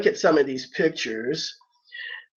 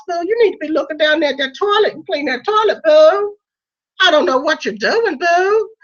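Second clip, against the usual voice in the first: high, fast, sassy drag diva; sassy drag queen; 10 semitones higher than usual. Acted. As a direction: camp scolding queen, honey boo. Drag persona scolding toilet cleaner.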